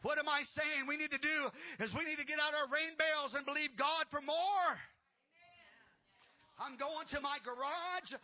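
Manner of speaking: loud, high fiery preaching; preaching at full force. Acted. Pastor urging the congregation, fiery loud preaching. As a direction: rouse the church to prepare for rain.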